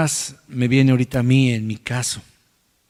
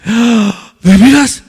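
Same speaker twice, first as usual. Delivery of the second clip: higher, louder astonished gasps; hammed-up humble astonishment; humble surprise; 8 semitones higher, 6 dB louder, slower than usual. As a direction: play it amazed and a bit flustered. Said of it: "el humilde dice".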